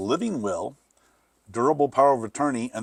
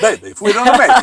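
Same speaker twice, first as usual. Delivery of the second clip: loud hammed-up Italian accent; higher, louder Italian accent; 8 semitones higher, 10 dB louder, faster than usual. Echoes the Italian accent bit.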